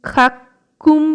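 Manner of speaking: slow খাক্কুম with a long held kk; doubled ক, drawn out. Shown as a demonstration. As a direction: split খাক্কুম at the kk, slowly. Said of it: "যে উচ্চারণটা করছি কয় কয়". (ধীরে খাক্কুম, জোড়া ক)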